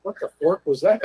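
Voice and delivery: normal talking voice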